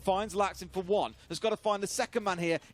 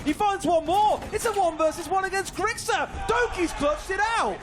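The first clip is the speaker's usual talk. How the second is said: rising hype play-by-play; esports caster; 7 semitones higher, 5 dB louder than usual. Acted. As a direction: call the clutch louder and louder. Live play-by-play building to clutch.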